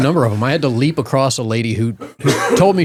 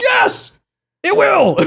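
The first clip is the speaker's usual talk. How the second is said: very high, exasperated mock yell; much slower than usual. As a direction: squeal the answer in acted outrage. Retold exasperated shout at driver.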